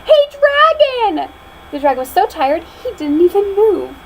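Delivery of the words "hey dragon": high, slow, drawn-out yell; loud shout. Acted. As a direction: yell right into his ear. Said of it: "shouted as loud as she could".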